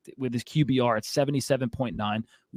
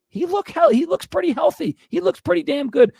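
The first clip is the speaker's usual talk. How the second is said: excited; 13 semitones higher, 3 dB louder than usual. Real, not acted. Heated rant peaks.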